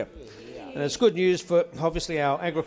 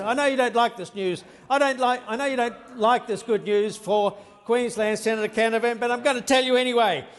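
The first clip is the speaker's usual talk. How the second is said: raised retort; 6 semitones higher than usual. Real, not acted. Minister retorts to heckling senator.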